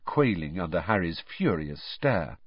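ordinary delivery, nothing marked

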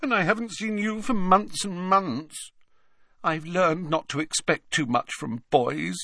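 put-on very high, wounded ghost-girl voice; high-pitched, plaintive accusation; reproachful ghost girl. A narrator's reading. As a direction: reproach him in a high whine. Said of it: "gave Harry a reproachful look".

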